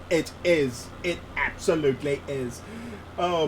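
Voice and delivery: ordinary talk, nothing marked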